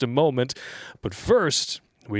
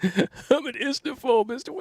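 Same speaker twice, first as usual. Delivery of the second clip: high, drawn-out mimicking voice; mocking echo; 12 semitones higher, slower than usual. Co-host parroting the other's odd word, mocking high voice. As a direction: parrot it back slow and very high.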